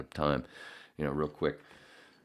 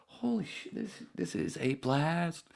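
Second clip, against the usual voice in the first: mock excited; 12 semitones higher, 4 dB quieter, slower than usual. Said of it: "You go".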